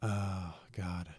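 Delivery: ordinary delivery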